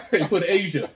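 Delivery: laughing. Real, not acted. Mocking a wrong quiz answer.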